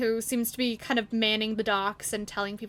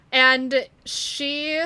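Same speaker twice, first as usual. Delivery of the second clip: ordinary delivery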